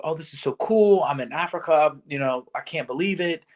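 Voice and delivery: normal talking voice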